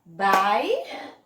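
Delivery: "Bairro" split in beats, loud rising first part; loud, separated syllables of "Bairro"; clapped syllable demo. Shown as a demonstration. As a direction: call out "Bairro" one syllable at a time. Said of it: "Vamos aqui pras palmas".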